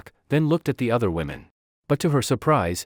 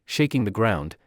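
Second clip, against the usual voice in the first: ordinary delivery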